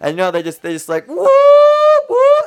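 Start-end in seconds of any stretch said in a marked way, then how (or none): 1.1-2.4 loud, very high mock whoop; whooping noise leaping way up in pitch; imitated whooping. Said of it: "they start making noises".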